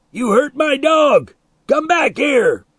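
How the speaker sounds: very high, loud yell; angry shout. A narrator's reading. Milo yells after departing boys, shouting. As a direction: holler after them in outrage. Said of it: "Milo shouted back".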